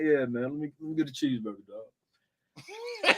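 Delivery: quiet, offhand and nonchalant. Retold or imitated. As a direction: toss it off like it's nothing. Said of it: "the shit was so nonchalant".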